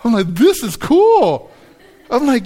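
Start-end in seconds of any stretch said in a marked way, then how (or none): 0.3-1.5 high, slow and loud marvel; delighted. Retold or imitated. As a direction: savour the surprise. Retold relief hanging in harness.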